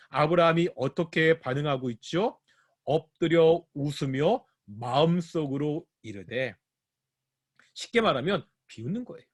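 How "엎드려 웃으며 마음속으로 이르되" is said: slow phrase-by-phrase scripture quote; slowed down, each phrase set apart; slow, pointed quoting. Shown as a demonstration. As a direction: draw the verse out for the listeners. Re-quoting the verse to explain it.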